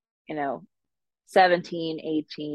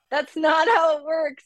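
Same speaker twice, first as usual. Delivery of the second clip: put-on higher Minnesota accent, exasperated; 12 semitones higher than usual. Daughter corrects mom about loans, Minnesota accent. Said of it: "dial up that accent".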